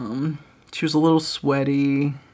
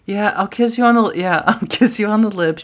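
higher and louder, half laughing; exasperated friend; 6 semitones higher, 6 dB louder, faster than usual. Retold or imitated. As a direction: give in with a giddy laugh.

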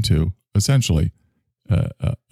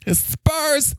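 higher, alarmed herald of doom; high, dramatic character voice. Acted. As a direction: announce the critics' invasion in a high, theatrical voice. Character voice continues.

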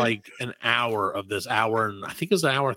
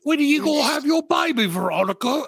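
higher, hammed-up British girl voice; mock British accent; 12 semitones higher than usual. Friends asking pregnant friend about baby, mock British accent. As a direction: squeal it in a put-on British accent. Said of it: "because of the British thing".

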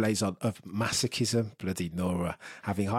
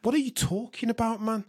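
exasperated; 11 semitones higher than usual. Real, not acted. Exasperated outburst at colleague.

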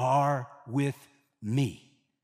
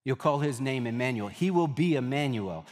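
ordinary delivery

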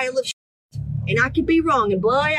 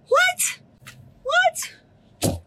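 very high-pitched incredulous squeak; exaggerated falsetto bafflement; mock disbelief. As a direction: squeal it upward as a baffled question. Mocking followers' basic diet questions.

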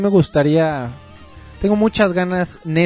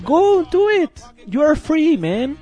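excited; 6 semitones higher than usual. Real, not acted. Ranting, excited advice to fans.

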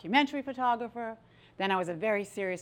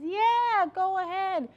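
high, drawn-out gleeful go-ahead; encouraging inner voice; 10 semitones higher, slower than usual. Acted. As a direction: voice the inner permission, pitched way up. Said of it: "switch that we all have inside of us that says".

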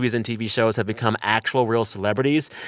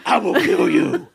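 high, louder snarling dog threat; voicing the dog; 11 semitones higher, 4 dB louder than usual. Dog barking at the post office guy, high mock threat. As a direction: growl it like a furious little guard dog. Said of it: "my dog is like".

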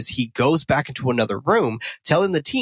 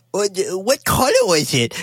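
lightly put-on small child voice, higher; mock little kid; 6 semitones higher than usual. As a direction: imitate a cutesy film kid, pitched up. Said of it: "act like they're four".

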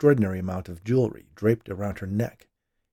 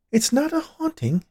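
very high, louder psychic voice; instructing medium; 5 dB louder than usual. A narrator's reading. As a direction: correct him as the expert medium. Medium corrects the husband.

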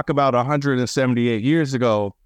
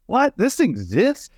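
much higher pitch, disbelieving question; acted astonishment; 9 semitones higher, slower than usual. Birder acting out first sight of the bird, astonished. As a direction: imitate stunned disbelief at the sight.

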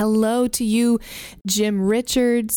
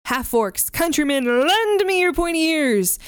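mock orator; 6 semitones higher than usual. Mock Shakespearean address opening the break.

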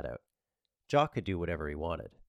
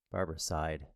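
ordinary delivery, nothing marked

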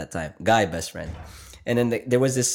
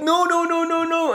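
much higher, a frantic string of no's; mock panicked refusal; 4 dB louder than usual. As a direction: squeal no like a cornered guy. Guy refusing in retold TikTok prank.

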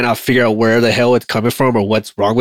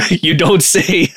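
drawn-out, higher, through laughter; laughing sarcasm; 6 semitones higher, slower than usual. Acted. Maintainer mocking an air crew's obvious write-up, laughing sarcasm. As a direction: laugh your way slowly through the mock surprise.

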